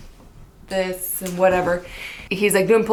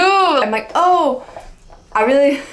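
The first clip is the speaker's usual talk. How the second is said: much higher and louder flustered gush; 7 semitones higher, 4 dB louder, slower than usual. Retold or imitated. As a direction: gush at him, voice shooting up. Retold flustered compliment.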